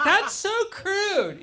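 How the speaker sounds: drawn-out, high offended lady; high mock-disgusted woman; mock offended girl. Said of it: "girls are like".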